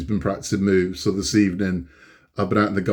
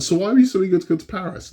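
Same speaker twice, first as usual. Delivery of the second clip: quick, high quizzing of son; mock interrogation; 9 semitones higher, faster than usual. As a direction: pin the kid down, fast and high. Questioning son.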